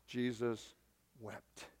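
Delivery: very soft, low, with a weighty pause; hushed reverence; solemn hush. Real, not acted. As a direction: let the last word drop almost to nothing. Preacher slows for solemn climax.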